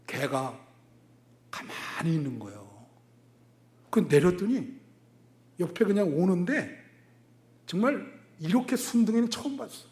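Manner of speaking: normal talking voice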